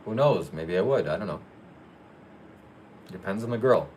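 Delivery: ordinary delivery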